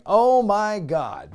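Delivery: imitating an announcer's awed disbelief; stunned gasp. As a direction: draw it out like a commentator struck speechless.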